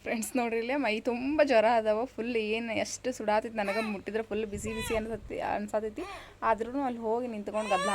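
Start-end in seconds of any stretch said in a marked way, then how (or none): none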